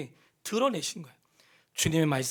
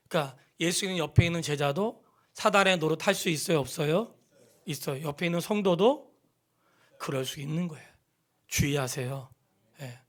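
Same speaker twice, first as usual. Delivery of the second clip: usual speaking voice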